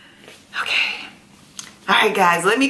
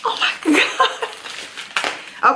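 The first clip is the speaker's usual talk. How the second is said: breathless laugh; 11 semitones higher, much slower than usual. Real, not acted. Laughing while opening kit.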